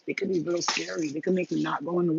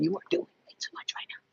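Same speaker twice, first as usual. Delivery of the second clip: firm whisper to your own thoughts; scolding whisper; 8 semitones higher, 5 dB quieter than usual. Pretend. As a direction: hush them, stern but unvoiced. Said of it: "Basically telling the thoughts to".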